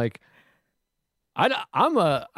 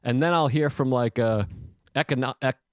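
normal talking voice

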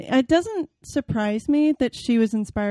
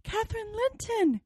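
high, thin shivering voice; high ghostly child's whisper of a name; 12 semitones higher, 6 dB quieter than usual. Acted. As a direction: answer like a freezing child ghost. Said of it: "it replied, shiveringly".

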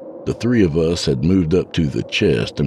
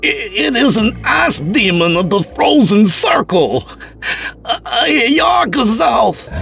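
panicked shout; 5 dB louder than usual. Acted. Terrified scholar names the demon.

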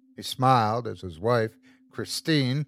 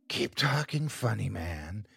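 whispering; 7 dB quieter than usual. A narrator's reading. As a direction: breathe it close to his ear. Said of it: "she whispered".

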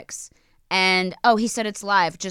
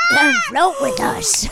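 high, drawn-out clown voice with laughter; creepy clown voice; 3 dB louder, slower than usual. Retold or imitated. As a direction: lure them into the sewer like a clown. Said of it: "it's from the clown from It".

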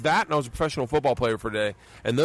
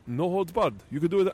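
a lightly put-on, bad Brazilian Gracie accent, a bit higher; mock a Gracie's Brazilian accent, slightly higher; horrible Gracie accent; 4 semitones higher than usual. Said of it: "I'm doing a horrible accent of a Gracie".

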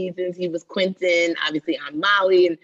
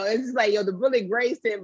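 ordinary delivery

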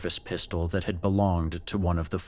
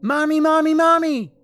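put-on much higher, loud child crying for mommy; child calling; 9 dB louder than usual. Creature voicing her little son calling for his mother, high and loud. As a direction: call like a small boy. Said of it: "with a much higher voice".

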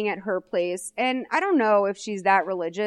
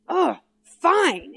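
mock-frustrated sigh, higher and louder; 8 semitones higher, 4 dB louder than usual. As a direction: huff it out like an annoyed teen boyfriend. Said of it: "he gets all frustrated".

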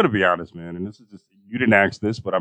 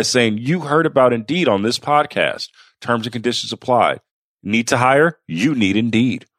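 hard sell ad; 4 semitones higher than usual. Acted. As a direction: punch the slogan like a commercial. Host reads a sponsor ad.

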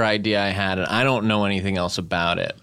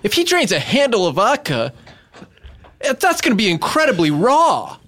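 mock alarm; 11 semitones higher, 4 dB louder than usual. Mock outrage at drinking show.

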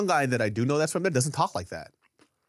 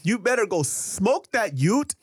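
high, slow Toronto-girl impression; the girl's voice; 6 semitones higher, slower than usual. Quoting the girl to check the meaning, high slow mimicry. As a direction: mimic her with drawn-out words. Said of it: "So when she was saying".

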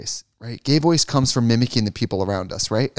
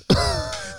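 high shrieking laugh. Real, not acted. Laugh after camp voice.

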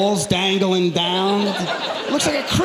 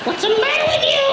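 extremely high, outraged mock shriek; shrieking girlfriend impression; 3 dB louder than usual. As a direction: protest in a piercing put-on woman's voice. Voices startled girlfriend after tackle.

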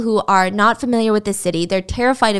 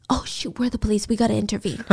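softer, half-whispered panic; hushed realization; 6 dB quieter, faster than usual. Acted. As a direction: mutter it so the suspects won't hear. Police whispering to each other.

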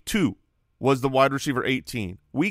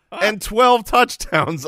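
excited; 6 semitones higher, 5 dB louder, much slower than usual. Real, not acted. Building stat reveal.

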